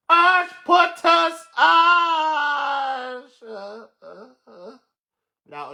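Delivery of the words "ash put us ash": a very slow, drawn-out higher yell; stretched-out yell. Real, not acted. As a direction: stretch the words out as you call. Calls out while laughing.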